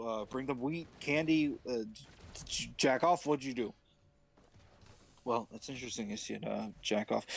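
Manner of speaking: sheepish Aaron. Acted. Aaron low voice continues.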